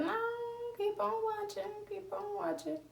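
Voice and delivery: high, soft, insistent coaxing; urging him on. Retold or imitated. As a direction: nudge him quietly to keep watching. Urging him to keep watching.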